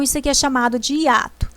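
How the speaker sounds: normal talking voice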